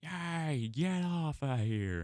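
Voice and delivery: high, drawn-out mock yell; YouTube telling off. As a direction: play YouTube shooing someone away, high and slow. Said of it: "YouTube is gonna be like".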